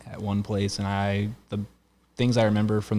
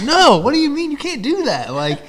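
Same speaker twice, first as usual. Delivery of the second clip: a much higher, much louder hammed-up protest; mock outrage; 9 dB louder than usual. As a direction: play up the betrayal, over the top. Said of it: "And I'm like".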